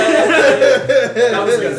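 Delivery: slow, high, laughing praise. Real, not acted. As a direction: laugh through it, drawn out. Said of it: "slow laughing praise".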